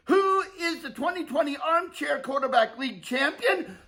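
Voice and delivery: rising hype announcer build-up. Pretend. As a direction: build the crowd up before the call.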